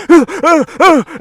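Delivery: loud, very high shrieks of alarm; acted startled panic yelps; panicked cries. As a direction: cry out in sudden fright, repeatedly. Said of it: "he starts to panic".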